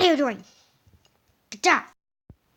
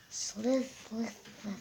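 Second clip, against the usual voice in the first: snake hissing; 8 dB quieter, much slower than usual. Pretend. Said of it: "can you stop saying slith".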